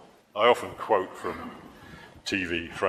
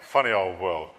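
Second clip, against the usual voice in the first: ordinary talk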